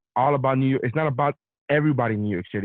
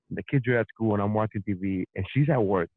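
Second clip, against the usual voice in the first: ordinary talk, nothing marked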